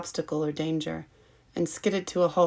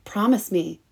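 louder, pressing plea; urgent plea; 3 semitones higher, 7 dB louder than usual. A narrator's reading. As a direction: insist harder, almost begging. Pleading more urgently.

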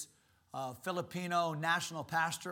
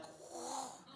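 breathy whew; 9 semitones higher, 11 dB quieter than usual. Retold or imitated. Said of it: "our people are like".

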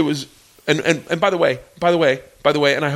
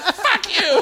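mock angry woman. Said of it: "She's like".

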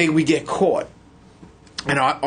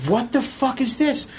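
high, startled what the fuck; high-pitched disbelief; shocked; 7 semitones higher than usual. Retold or imitated. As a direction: recoil at seeing the tags. Retold shock at mom's tags.

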